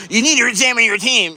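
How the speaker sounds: high sneering mock voice of a critic. Streamer mocks a chatter who criticises his team, high sneer. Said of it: "here's a guy that's like".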